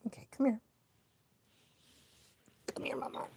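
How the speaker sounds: soft whisper to the dog; whispered call. Real, not acted. Owner coaxing the dog over, whispering.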